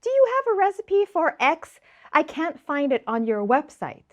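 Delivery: high-pitched imitation of a follower asking; high mock-questioning voice; puzzled follower. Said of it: "people are like".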